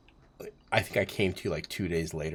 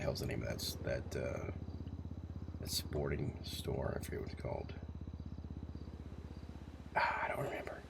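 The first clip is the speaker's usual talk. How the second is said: muttered aside; 6 semitones lower, 10 dB quieter than usual. Real, not acted. Muttering to himself, forgetting a name.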